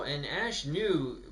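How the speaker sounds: ordinary delivery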